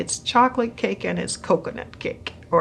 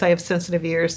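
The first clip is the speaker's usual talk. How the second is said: ordinary talk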